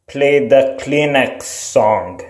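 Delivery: ordinary delivery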